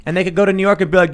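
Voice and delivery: ordinary talk, nothing marked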